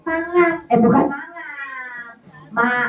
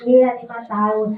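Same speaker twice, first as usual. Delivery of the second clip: ordinary talk, nothing marked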